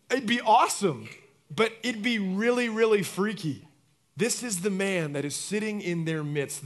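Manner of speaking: usual speaking voice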